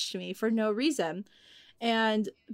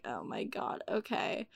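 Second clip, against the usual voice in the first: resigned aside; 13 dB quieter than usual. Retold or imitated. Teen worker summoned by the customer's mom, quietly resigned. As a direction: drop the voice right down, giving in. Resigned reaction to mom's request.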